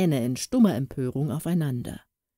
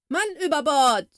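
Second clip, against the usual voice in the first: alarm call; 11 semitones higher, 4 dB louder than usual. A narrator's reading.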